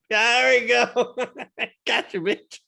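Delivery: high laughing gloat; laughing triumph. Real, not acted. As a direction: crow with laughter.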